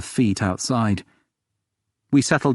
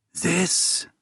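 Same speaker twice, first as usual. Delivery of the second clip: a higher, rasping query with an odd lilt; dusty rasp; 8 semitones higher, 4 dB quieter than usual. A narrator's reading. Long-isolated woman asks about the locator, in a dry rasp. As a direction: rasp it like a voice unused for years. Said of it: "Her voice is like dust, just a rasp and a weird intonation".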